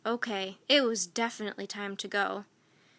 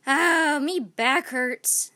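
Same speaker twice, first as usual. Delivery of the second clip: slow, louder pained moan; cried; 3 semitones higher, 8 dB louder, much slower than usual. A narrator's reading. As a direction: groan it out slowly. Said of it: "Stinky cried".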